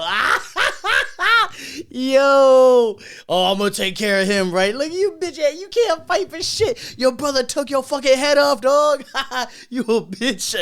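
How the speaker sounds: high, loud, cackling mock taunt; mocking laughing taunt. Mocking the coworker who lost a fight, laughing loud. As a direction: laugh in his face while roasting him. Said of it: "and I just go".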